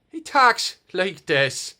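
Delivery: high, loud, slow stage-Irish lilt; cad Irish accent. Shown as a demonstration. Dad introducing the puppet elf in Irish accent. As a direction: ham up a cheesy Irish brogue. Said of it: "with a cad Irish accent".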